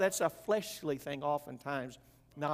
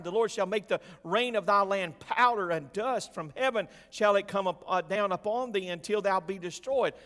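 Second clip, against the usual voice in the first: mock biblical prophet; 4 semitones higher, 6 dB louder than usual. Proclaiming curses from scripture.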